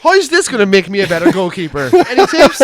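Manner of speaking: high, loud keen voice; mock eager student. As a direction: beg for tips like an eager young goalie.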